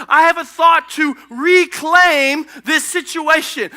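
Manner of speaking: high, loud, urgent declaration as God; impassioned proclamation. Acted. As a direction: proclaim the rescue plan with rising fervour. Acts God's impassioned rescue plan.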